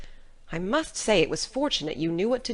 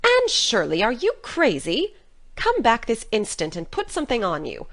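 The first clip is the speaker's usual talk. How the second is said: put-on loud, swooping older-woman holler; scolding call; 3 dB louder than usual. A narrator's reading. Marilla calling Anne back to wrap up, loud scolding. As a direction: call after her in sharp disbelief.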